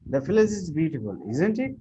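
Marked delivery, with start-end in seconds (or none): 1.3-1.8 isn't it with the voice swooping much higher; rising tag question. Shown as a demonstration. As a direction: lift isn't it high like a real question. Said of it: "the portion you are raising your voice".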